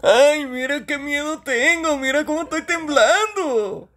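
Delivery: high, drawn-out mocking character voice; mock fear in a slow, high comic voice; slow high-pitched sing-out, sarcastically scared; Toñito imitation. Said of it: "por qué imitas la voz de Toñito".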